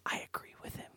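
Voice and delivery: unvoiced confession whisper. Real, not acted. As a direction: whisper it like a secret.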